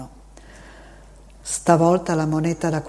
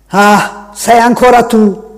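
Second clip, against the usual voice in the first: gruff exclamation; 5 semitones higher, 11 dB louder, slower than usual. A narrator's reading. Man snaps at the boy again.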